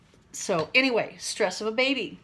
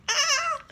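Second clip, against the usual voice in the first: a sharp high yelp as the scalp itches; quick high startled cry; sudden yelp. Real, not acted. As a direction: yelp out in surprise, high and short. Itchy scalp mid-sentence.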